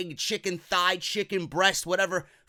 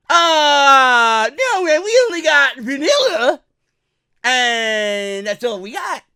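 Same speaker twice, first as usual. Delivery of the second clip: higher, loud put-on employee; lazy buffet employee; 5 semitones higher, 9 dB louder, slower than usual. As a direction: drawl it as a couldn't-care staffer. Employee voice again.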